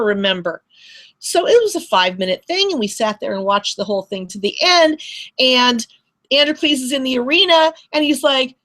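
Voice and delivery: ordinary delivery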